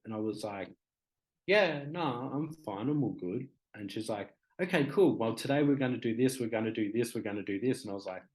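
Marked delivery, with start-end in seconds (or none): 1.5-3.5 higher-pitched, casual insistence all is fine; acted-out "nothing wrong here" reassurance; breezy brush-off. Brushing off worker's concern. 4.6-7.8 imitated worker rattling off the day's plan; brisk, organising helper voice; chirpy support worker. Imitating support worker listing plans.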